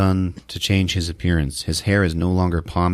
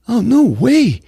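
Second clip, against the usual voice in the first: high put-on refusal; mock kid voice, pitched up; whiny brother; 4 dB louder, slower than usual. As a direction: protest like a whining kid. Acting Melvin refusing to pay.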